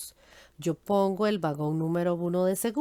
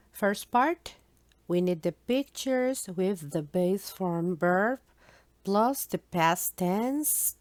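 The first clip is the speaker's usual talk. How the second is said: slow English read; slower than usual. Shown as a demonstration. Teacher reads project list.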